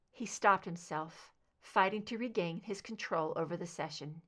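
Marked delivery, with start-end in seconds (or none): none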